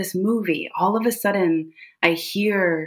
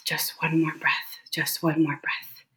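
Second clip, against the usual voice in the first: breathless whisper to herself; 2 semitones lower, 6 dB quieter than usual. Retold or imitated. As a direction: barely whisper it, clinging on. Breathing through a medical crisis.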